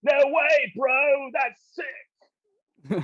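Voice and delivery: put-on high, loud, hyped-up Australian accent. Aussie impressed by snow surfing, shouted Australian accent. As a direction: shout it like an amazed Aussie surfer. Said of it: "I'll do my Australian accent".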